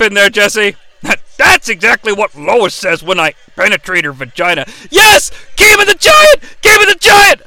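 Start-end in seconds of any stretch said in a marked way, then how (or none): 4.9-7.4 high, loud gleeful chant; excited shout. Real, not acted. Cheering the Giant to his co-host, loud chant. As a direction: yell it like a rabid fan.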